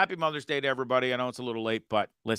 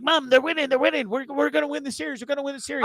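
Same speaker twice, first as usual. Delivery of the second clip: excited son; 10 semitones higher, faster than usual. Retold or imitated. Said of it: "And be like".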